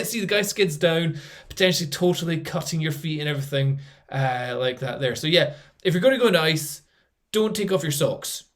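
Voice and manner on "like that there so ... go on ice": normal talking voice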